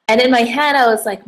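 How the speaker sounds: usual speaking voice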